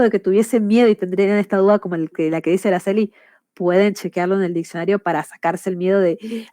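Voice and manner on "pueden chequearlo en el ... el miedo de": ordinary delivery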